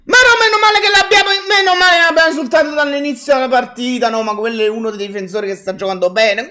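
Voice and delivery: rapid, bitter rant; fast rant. Real, not acted. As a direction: rattle it off fast. Fan rants in frustration.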